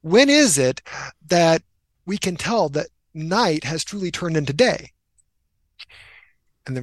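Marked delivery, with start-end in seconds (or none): 0.0-4.9 higher, louder pleading; students' exasperated plea for the answer, higher and louder; frustrated students. Retold or imitated. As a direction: beg the teacher for the answer, exasperated. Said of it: "they're all frustrated and they're like".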